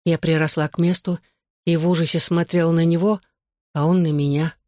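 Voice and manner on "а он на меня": ordinary delivery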